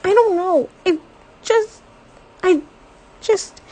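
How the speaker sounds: high, loud, breathless and faltering; gasping out broken words, close to tears; breathless upset. A narrator's reading. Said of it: "She's breathing fast".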